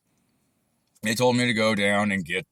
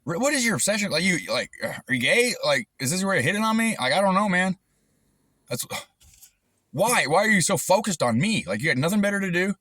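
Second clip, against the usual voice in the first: exasperated rant; 10 semitones higher than usual. Real, not acted. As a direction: demand answers, fed up and incredulous. Indignant rant at the harasser.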